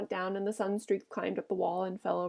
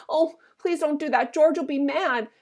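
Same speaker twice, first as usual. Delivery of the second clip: higher, louder pleading; panicked begging; 6 semitones higher, 6 dB louder than usual. A narrator's reading. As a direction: plead in fear of getting in trouble. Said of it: "he begged".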